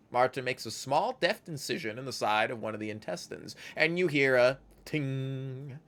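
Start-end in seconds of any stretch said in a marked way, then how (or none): none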